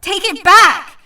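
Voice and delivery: loud, furious demand; angry shout. Acted. Kitty yells at Danny in a fight, angry shout. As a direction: yell it in his face. Girl fights boy in studio.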